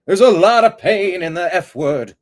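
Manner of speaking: a hint of a Southern belle; odd mock accent. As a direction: ham up a silly accent. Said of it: "I don't know what accent that was".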